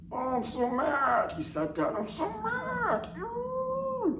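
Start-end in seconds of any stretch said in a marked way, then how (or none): 0.1-1.3 high, slow, squeaky God voice; mock whiny God. Comic God whining about being mad, high and slow. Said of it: "just like that". 1.9-4.2 high, slow, squeaky God voice; mock whiny God. Comic God whining about being mad, high and slow. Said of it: "just like that".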